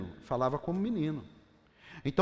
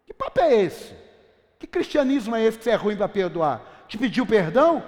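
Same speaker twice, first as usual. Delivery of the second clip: loud, higher, indignant preaching; loud rebuke; 4 semitones higher, 9 dB louder than usual. Real, not acted. Pastor scolding believers who refuse to forgive, loud and indignant. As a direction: scold the church for not forgiving.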